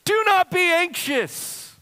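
very high, slow, drawn-out cry; mock wail. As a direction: bawl the command in an exaggerated childish voice. Mock wailing at Scripture's command.

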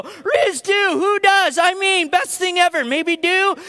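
high mock jargon of church staff; mocking staff lingo. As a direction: rattle off in-group lingo. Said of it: "they'll listen to this lingo".

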